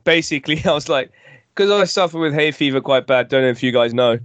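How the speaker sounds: ordinary talk, nothing marked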